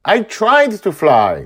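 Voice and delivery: put-on high, loud, slow girl's shout. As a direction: shout it up high like an upset girl. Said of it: "she shouts at him".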